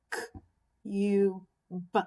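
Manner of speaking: "K, U, B" as cube split into k, held long u, b; "K, U, B" tapped out phonetically, not as letter names for "K" and "B"; sounded out. Shown as a demonstration. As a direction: separate each sound of "K, U, B" with clear pauses. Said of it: "you can tap out the word".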